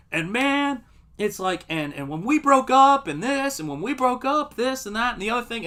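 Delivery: high-pitched, overwrought breakup rant; hammed-up self-pitying ramble; mock heartbroken rant. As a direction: gush it out like a dumped guy pouring his heart out. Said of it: "I'm, like, mid-rant".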